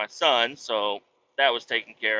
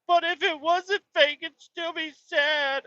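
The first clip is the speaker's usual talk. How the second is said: put-on much higher, slowing little-boy plea; whiny kid; slower than usual. Dad imitating his son arguing that it's still sad, whiny. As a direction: argue it like a teary little boy.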